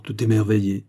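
usual speaking voice

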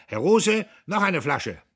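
loud call across the room. A narrator's reading. Diederich calling the innkeeper to save face, loud. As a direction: shout for another bottle to cover the scene. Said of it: "rief er".

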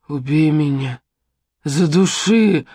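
slow, broken plea to be killed; begging. A narrator's reading. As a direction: beg for death, dragging the words out. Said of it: "умолял меня Тед". (медленная надломленная мольба)

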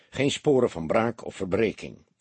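plain reading voice

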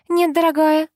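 put-on light Armenian-tinged accent. A narrator's reading. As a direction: answer with a slight foreign accent. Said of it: "с лёгким акцентом ответили".

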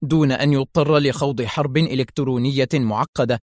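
usual speaking voice

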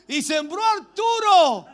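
loud, high, drawn-out proclamation; llamado a viva voz. Real, not acted. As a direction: call it out loud and long to the congregation. Calls out names to congregation. (proclamación fuerte y alargada)

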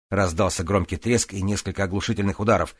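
ordinary delivery

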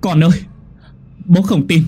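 high, loud howl of pain; anguished cry. Acted. Father shouting to his dead son's spirit, anguished. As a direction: cry out desperately for a lost son. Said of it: "Anh gào lên trong đau đớn".

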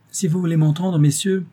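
high, loud, cutting society coquette; biting. A narrator's reading. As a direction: answer them with mordant wit. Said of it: "de la voix mordante des Célimènes".

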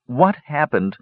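mildly frantic, pitch lifted; feverish demand. A narrator's reading. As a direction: demand the answer anxiously. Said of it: "Palin demanded feverishly".

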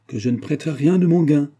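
ill-humoured. A narrator's reading. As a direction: refuse testily, raising the voice. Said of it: "répondit le chevalier avec humeur". (avec humeur, en haussant le ton)

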